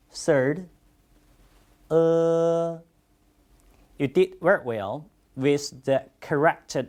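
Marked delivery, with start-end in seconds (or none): 1.9-2.8 uh held long and level; drawn-out, even uh vowel; pronunciation demo of uh. Shown as a demonstration. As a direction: sustain uh on one steady pitch. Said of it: "about the pronunciations".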